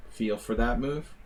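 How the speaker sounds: ordinary talk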